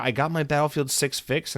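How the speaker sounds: ordinary talk, nothing marked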